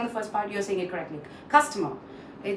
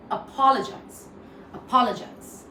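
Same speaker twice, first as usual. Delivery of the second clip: a-POL-ogize, weight on POL; second-syllable stress; 3 semitones higher than usual. Shown as a demonstration. Teacher drills the correct stress, second part strong. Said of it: "second part is stress".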